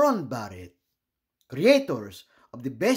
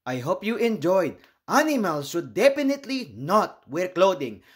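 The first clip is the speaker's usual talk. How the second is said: ordinary delivery, nothing marked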